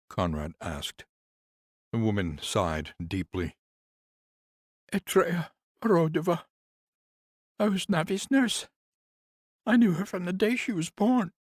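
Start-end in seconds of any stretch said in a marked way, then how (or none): 4.9-11.3 much higher, louder woman's voice, bitter; grieving nurse. Acted. Nurse complains to Conrad about the house, higher voice. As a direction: lift into the nurse's aggrieved voice.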